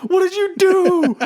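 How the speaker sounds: high, slow, laughing panic; panicked brother. Retold or imitated. Retelling his brother's panic, high and laughing. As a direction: squeal it out in shock, half laughing.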